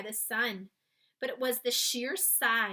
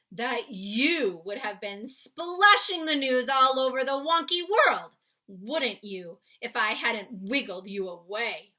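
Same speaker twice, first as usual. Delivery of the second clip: big, loud friendly giant explaining; 10 dB louder than usual. Acted. Giant explaining to Sophie why he took her. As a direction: boom it out like a kindly giant. BFG voiced in read-aloud.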